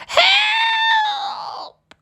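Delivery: very high screamed help; witch cries help. A narrator's reading. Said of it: "cried the witch".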